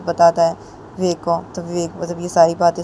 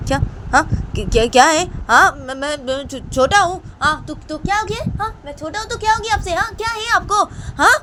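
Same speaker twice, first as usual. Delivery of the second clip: high, stammering protest; splutter, climbing ever higher in pitch; flustered indignation; 11 semitones higher, 4 dB louder than usual. A narrator's reading. Student objects to his teacher calling him small, stammering and indignant.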